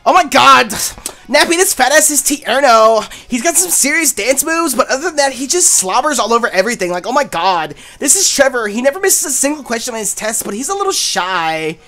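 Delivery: Starbucks voice. Acted. Player voices Serena introducing two friends, Starbucks voice. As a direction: gush through the introductions in character.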